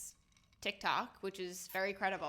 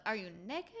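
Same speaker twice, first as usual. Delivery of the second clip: a quieter, rising stunned question; quiet mock disbelief; 4 dB quieter than usual. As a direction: say it as if you can't believe your eyes. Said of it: "No, like".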